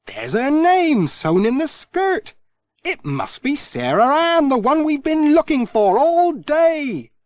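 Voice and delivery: triumphant, the lost doll found at last; delighted discovery. Acted. Pat realises the doll is found.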